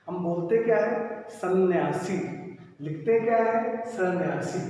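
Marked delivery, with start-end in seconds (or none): none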